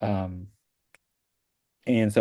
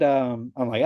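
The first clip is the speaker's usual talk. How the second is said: usual speaking voice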